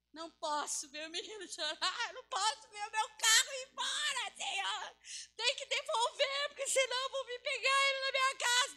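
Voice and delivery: hammed-up tearful begging to God; mock desperate plea. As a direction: build from wail to shrieking demand. Acts out believer's desperate plea.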